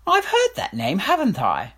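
high voice of a puzzled king; a vain king half-remembering; frowning king. Acted. King questioning Major Beamish, high and puzzled. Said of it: "said the king, frowning".

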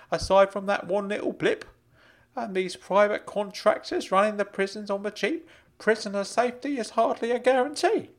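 high, insistent uncle voice; Uncle Claude, worried. Acted. Uncle questions Frank about prison abuse, high and concerned. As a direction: press the concern in a high character voice.

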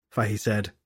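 usual speaking voice

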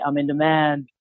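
low, playful coaching demo. Shown as a demonstration. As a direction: drop the pitch for a confident mantra. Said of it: "You gotta lower your voice".

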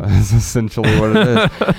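laughing as the words come out; amused laughing. Real, not acted. Co-host repeats his friend's accidental pun, laughing.

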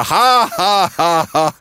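mock ha-ha, starting high; put-on laugh, dropping in pitch; imitated police laughter. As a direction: act out the officers' big reveal laugh. Imitating police reacting to ring.

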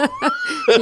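a sudden high squeal of a laugh; high burst of laughter. Real, not acted. Co-host laughing at his own Booker joke, high squealing laugh.

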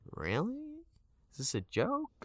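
very high, squeaky disbelief; incredulous. Retold or imitated. Actor hearing of a surprise role offer, incredulous. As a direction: let the pitch shoot up in mock astonishment. His stunned reaction to an offer.

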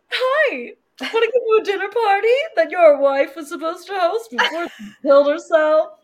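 high, loud cheerful invitation; bubbly hostess cheer, laughing through; overly chipper. Retold or imitated. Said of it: "Bree's like".

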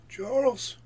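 loud, high call from behind. Acted. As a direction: hail someone sharply by name. Voice calling from behind.

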